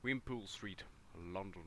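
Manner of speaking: ordinary talk, nothing marked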